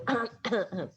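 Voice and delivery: a scratchy throat clear. Retold or imitated. Imitating people in meetings, a throat clear. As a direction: clear the throat like someone parched. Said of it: "people start going".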